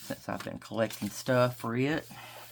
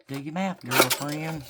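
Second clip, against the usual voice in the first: louder, higher teasing reprimand to herself; mock self-scolding; 6 semitones higher, 9 dB louder than usual. Real, not acted. Crafter scolding herself for bad math, mock sassy. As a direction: scold yourself like a sassy friend.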